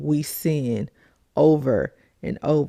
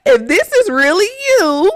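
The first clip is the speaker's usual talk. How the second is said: high, loud, giggling doubtful plea; amused mock-skeptical bargaining; laughing Gideon; 6 semitones higher, 3 dB louder than usual. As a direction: plead with God through laughter. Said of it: "He said".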